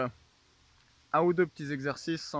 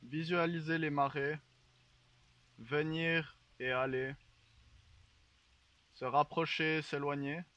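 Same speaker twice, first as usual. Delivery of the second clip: guided meditation; 5 dB quieter than usual. Acted. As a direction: lull them into calm. Leads a mock guided meditation.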